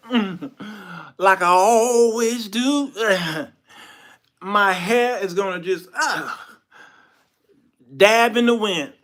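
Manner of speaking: slow, high, loud strongman bragging with grunts; boastful Samson. Acted. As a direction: stretch out the words like a cocky hero. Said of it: "He said".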